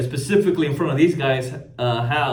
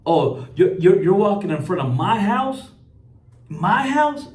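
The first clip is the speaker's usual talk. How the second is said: mocking the homeowner; 5 semitones higher than usual.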